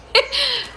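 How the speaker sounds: sudden high giggle. Real, not acted. Giggles high after joking about Lord of the Rings. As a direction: giggles high at her own joke. Laughing at own joke.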